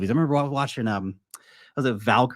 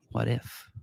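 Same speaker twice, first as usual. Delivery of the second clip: hushed echo; 9 dB quieter than usual. Real, not acted. Host letting the contrarian idea sink in, hushed echo. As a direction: drop it to a quiet, pondering echo.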